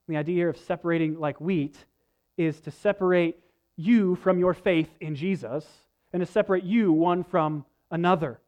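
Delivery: normal talking voice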